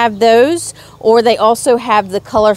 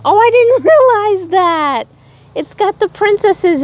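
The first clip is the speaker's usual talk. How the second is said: surprised; 10 semitones higher than usual. Real, not acted. Locket opens unexpectedly.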